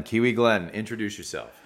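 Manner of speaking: ordinary delivery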